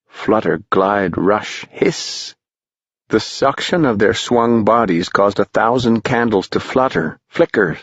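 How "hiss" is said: whispered hiss. A narrator's reading. Narrator hissing the word.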